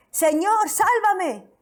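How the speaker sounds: high, loud cry for help; a pleading shout; desperate cry. A narrator's reading. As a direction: Peter sinking, crying out to be saved. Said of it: "Entonces gritó".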